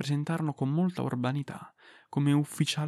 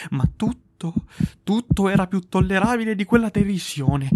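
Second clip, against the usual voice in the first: loud, high, frantic outburst; about to crack under the mockery; desperate fury; 4 semitones higher, 9 dB louder than usual. A narrator's reading. Tormented killer, mocked by the officers, in frantic fury. Narrator driven to screaming.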